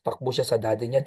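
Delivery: ordinary talk, nothing marked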